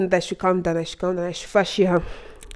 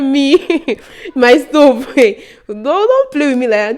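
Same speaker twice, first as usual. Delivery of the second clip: laughing, loud disbelief; high, incredulous protest through laughs; acted shock; 6 semitones higher, 6 dB louder, slower than usual. Student accused over her own stove, laughing shocked protest.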